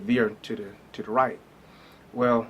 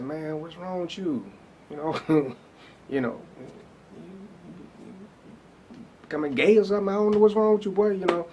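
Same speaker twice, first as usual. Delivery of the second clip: scolding himself; 7 semitones higher than usual. Retold or imitated. Alone in bed, scolding himself for sending her away, incredulous. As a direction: raise the pitch and chew yourself out in disbelief.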